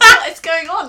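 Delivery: excited exclaim. Retold or imitated. Sam retelling her shocked reaction, loud and laughing. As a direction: burst out in laughing disbelief. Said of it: "I was like".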